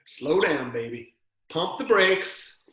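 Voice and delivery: loud, drawn-out mock warning; playful call-out. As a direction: holler it in jest, stretching the last words.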